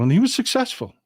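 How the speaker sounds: bright jump in pitch on "successful"; excited. Acted. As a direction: announce the win with a leap of pitch. Triumphant repeat of success.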